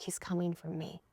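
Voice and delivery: hushed inner voice, half-voiced; private thought. Woman in the car thinking of him, hushed. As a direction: say it softly to yourself. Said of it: "And in my mind".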